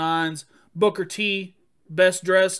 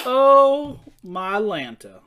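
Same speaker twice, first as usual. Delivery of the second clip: put-on old catchphrase; 2 semitones higher, 7 dB louder, much slower than usual. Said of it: "you just showed your age".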